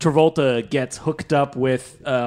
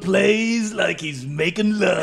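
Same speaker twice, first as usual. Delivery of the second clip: slow, drawn-out hammed-up review; teasing mock-critic delivery; mock album review; 3 semitones higher, slower than usual. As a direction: stretch it out like a playful, overdone critic. Mock album review of guitar playing.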